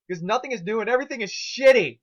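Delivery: loud high frustrated scream. A narrator's reading. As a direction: scream it, totally fed up. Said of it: "Twilight screamed".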